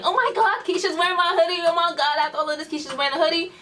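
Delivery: high-pitched mock outburst, speeding up; retold yelling. Boyfriend spots his hoodie on Keisha, mock yelling. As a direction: imitate an exasperated yell without real volume. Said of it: "I heard him yelling".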